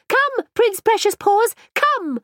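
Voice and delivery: high, loud calling to the dog. A narrator's reading. Mirabelle calling her dog back, loud and high. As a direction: raise your voice across the water. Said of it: "Mirabelle raised her voice".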